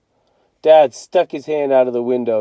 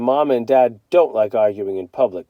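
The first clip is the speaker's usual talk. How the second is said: ordinary delivery, nothing marked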